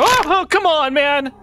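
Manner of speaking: high exasperated yell; frustrated outburst. Real, not acted. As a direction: groan it out in frustration.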